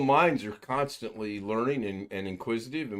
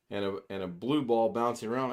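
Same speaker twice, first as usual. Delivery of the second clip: normal talking voice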